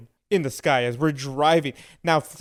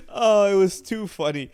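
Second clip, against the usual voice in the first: usual speaking voice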